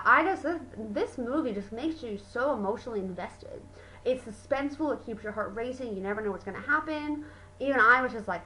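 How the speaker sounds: usual speaking voice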